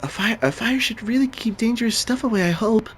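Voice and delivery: high, light voicing of the girl; imitating her voice. Shown as a demonstration. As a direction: read it the way she would, lifted up high. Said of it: "That's how I say it".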